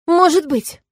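high, loud challenge; defiant. A narrator's reading. Woman answers the jealous dragon, defiant. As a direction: throw it back at him defiantly. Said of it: "с вызовом ответила я".

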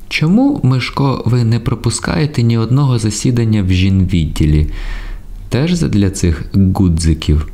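high-pitched questioning woman character; Айзі's voice. A narrator's reading. Айзі challenges Мишко about skipped meetings, high-pitched questioning. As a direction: start very high and let the question descend.

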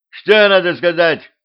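high, loud demand; sharp shout. A narrator's reading. King to his disappointed nobles, loud shout. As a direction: shout it as a ruler's prompt. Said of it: "прикрикнул".

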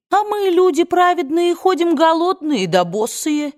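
high-pitched, plaintive complaint; indignant lament. A narrator's reading. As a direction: wail it like an aggrieved old servant. Mammy laments the injustice to righteous folk.

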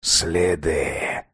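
put-on low, soft, deliberate old man; old vampire quietly concludes; old man's low voice. A narrator's reading. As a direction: drop it quietly, knowingly.

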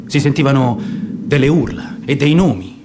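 plain reading voice

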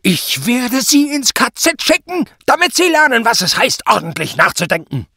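very high, loud shouting; screaming threat. A narrator's reading. Superior threatens Zott with the camp, screaming. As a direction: cut him off with a screamed threat. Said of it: "schrie Prall".

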